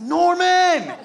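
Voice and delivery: high, loud mock cheer; crowd cheering. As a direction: yell his name like a crowd. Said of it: "They were just like".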